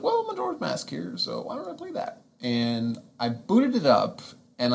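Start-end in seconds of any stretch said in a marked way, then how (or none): 0.0-2.2 much higher, bright inner thought. Retold or imitated. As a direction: quote yourself in a high voice. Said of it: "and I was like".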